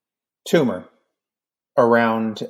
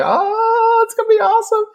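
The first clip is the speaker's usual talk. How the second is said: very high squeal of nerdy glee; hammed-up fanboy delight; giddy excitement; 5 dB louder than usual. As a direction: geek out in a sky-high voice. Giddy at picturing laser battles.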